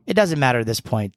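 ordinary talk, nothing marked

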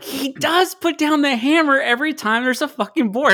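squeaky-high mock outburst; high-pitched exclamation. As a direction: jump up high, mimicking your own reaction. Quoting own excited reaction to film.

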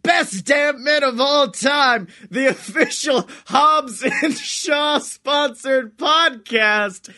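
big ringside-style introduction, key words stretched; hype announcer. Acted. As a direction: announce the show like a grand ring emcee. Hypes up the podcast intro.

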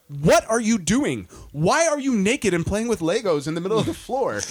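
a mom catching her son at mischief; exasperated mom. Retold or imitated. Mimicking his wife finding the naked son, exasperated.

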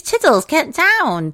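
high, loud cartoon vet calling her pet; Doctor Hamster. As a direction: call it out high, stretching the words. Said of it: "And she goes".

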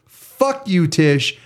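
high-pitched furious rant, spitting out the curses; angry rant. Real, not acted. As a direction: vent at the state with real rage, voice cracking high. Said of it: "now I'm gonna go on my rant".

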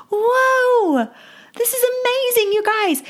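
real excitement, pitch way up, voice cracking with joy; amazed. Real, not acted. Exclaims wonder at the story.

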